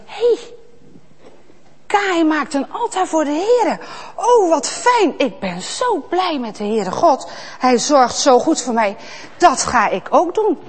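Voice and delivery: put-on high, bright excited boy; happy Abel. Abel joyfully seeing Kaïn's altar, excited. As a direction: sound delighted and eager.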